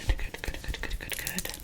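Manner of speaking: soft repeated whispered trigger words; tingly asmr mouth sounds. Real, not acted. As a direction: whisper it close and crisp.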